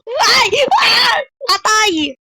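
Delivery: high, loud frightened shrieks; panicked high yells; terrified screams. Real, not acted. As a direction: scream in real fright at the jump scare. Jump scare in horror game.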